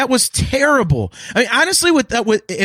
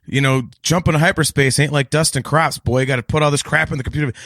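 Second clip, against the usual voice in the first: a hint of a cocky smuggler lecturing a kid; Han Solo impression; 7 semitones lower than usual. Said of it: "Fucking Han Solo".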